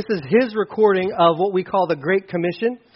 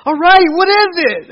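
eager; 11 semitones higher, 8 dB louder than usual. Retold or imitated. Family eager about the package.

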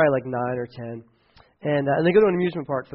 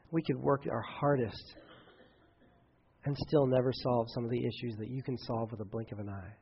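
soft, lowering prayer voice; quiet earnest pastor's prayer; closing prayer; 3 semitones lower, 4 dB quieter than usual. Acted. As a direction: pray over the congregation, hushed.

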